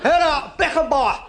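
put-on German accent. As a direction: bellow the names slowly like a German fan. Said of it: "this dirty big German went".

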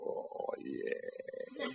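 very soft, low, drawn-out relish; hushed, lecherous murmur; lustful sigh. Acted. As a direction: sigh it slowly with desire. Castaway aroused by the rescued girl.

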